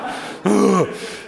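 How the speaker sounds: heavy exasperated sigh; mock-weary exhale; acted sigh. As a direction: let out a big dismayed breath. Reacting as Gideon losing his men.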